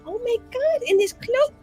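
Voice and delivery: ordinary talk, nothing marked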